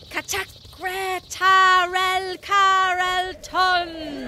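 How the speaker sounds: slow, drawn-out spell chant; slow incantation. Acted. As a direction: intone the spell slowly. Reading the magic scroll.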